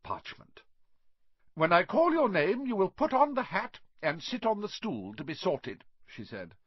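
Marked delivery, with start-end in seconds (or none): 1.5-5.8 a hint of a stern professor, higher and louder at the start; stern teacher. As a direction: announce the rules to the nervous first-years. McGonagall addresses first-years.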